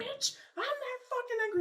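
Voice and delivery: very high-pitched imitation of an angry caller; mock angry retort. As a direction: exaggerate her angry denial, way up high. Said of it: "You sound so angry".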